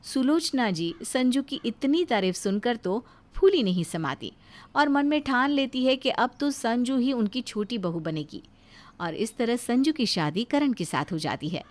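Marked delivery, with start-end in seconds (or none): none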